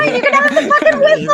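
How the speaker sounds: mock whiny hero. Friends mocking Edmund's duel, high loud sulky whine. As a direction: squeal it out like a sulky loser handing over a toy.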